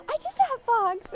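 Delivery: high, loud, drawn-out delight; excited exclamation. Retold or imitated. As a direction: re-enact a proud little cheer. Retells own proud delight.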